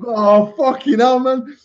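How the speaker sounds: high, slow, laughing "oh fucking hell"; laughing panic. Retold or imitated. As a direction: laugh through the panic as you retell it.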